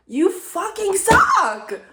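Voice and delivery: high, slow, drawn-out curse; over-the-top mock anger; mock furious yell. Real, not acted. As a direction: stretch each word out in exaggerated outrage. Cursing November directly.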